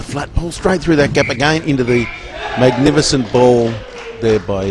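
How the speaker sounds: excited goal call. Real, not acted. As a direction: let the voice surge as the ball goes through.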